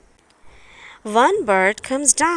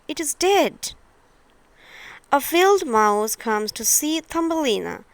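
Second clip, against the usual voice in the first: plain reading voice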